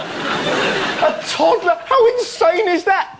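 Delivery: high, slow disbelief; can't believe it; incredulous. Real, not acted. Incredulous at toddler boot camp.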